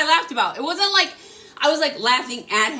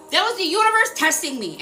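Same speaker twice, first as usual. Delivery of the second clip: normal talking voice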